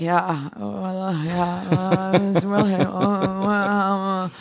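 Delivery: imitating a worn-out interviewee groaning; hammed-up tired, droning moans; mock exhaustion. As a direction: drag out the sounds as if drained. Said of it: "when you were all animated and awake".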